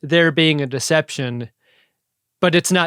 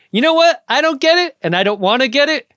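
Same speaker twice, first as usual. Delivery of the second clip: louder, higher defiant captain; gruff Archer; 6 semitones higher, 3 dB louder than usual. Retold or imitated. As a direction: bark it with stubborn resolve. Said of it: "He's like".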